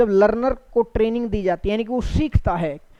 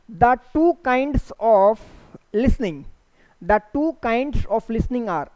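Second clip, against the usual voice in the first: slow reading; much slower than usual. Shown as a demonstration. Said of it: "teacher reads question slowly".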